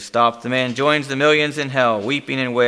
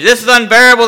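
raised, anguished outcry; crying out; 10 semitones higher, 11 dB louder, faster than usual. A narrator's reading. Doomed man plunging toward hell, crying out. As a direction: cry out in unbearable torment. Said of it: "the man cries out".

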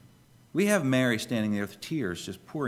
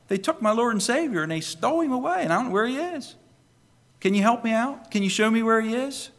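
pleading Mary; 11 semitones higher, 4 dB louder than usual. Acted.

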